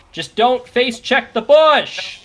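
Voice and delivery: loud, high, drawn-out cry; shouted warning. Real, not acted. Caster warns players off the bush, shouted warning. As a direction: yell it slowly at players walking into danger.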